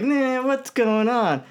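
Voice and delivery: high, drawn-out carefree voice; exaggerated high-pitched civilian voice; Bruce Wayne. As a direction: imitate a lighter everyday alter ego, slow and high. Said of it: "'Cause Bruce Wayne was like".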